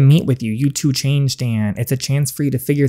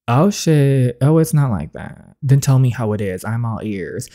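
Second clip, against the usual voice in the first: ordinary talk, nothing marked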